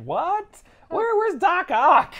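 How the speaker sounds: high, squeaky mock confusion; baffled squeak, drawn out slowly; confused reader. Reader baffled by eighties villains, squeaks in mock confusion. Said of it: "You're like".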